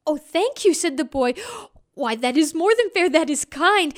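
put-on boy thanking the wind for the magic cloth; grateful boy. A narrator's reading. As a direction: gush with gratitude.

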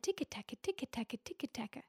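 tapping sound. A narrator's reading. Tapping sound of leprechaun's hammer.

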